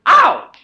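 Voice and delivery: high yelp of pain; sharp ouch. Acted. Jack struck in the face by a snowball, high yelp.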